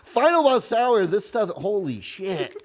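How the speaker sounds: hammed-up product pitch, slow; corny ad voice. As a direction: oversell it like a cheesy ad. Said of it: "do a Chamber Media ad".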